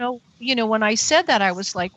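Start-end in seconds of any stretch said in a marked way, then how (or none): none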